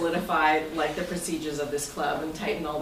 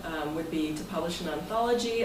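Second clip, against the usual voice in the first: ordinary talk, nothing marked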